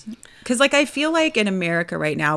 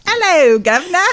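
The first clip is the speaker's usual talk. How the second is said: high, loud Cockney caricature; mock English accent; 10 semitones higher, 10 dB louder than usual. Co-host joining in the bad accent bit, mock English accent. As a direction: chirp it in a hammed-up English accent. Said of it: "trying to do an English accent".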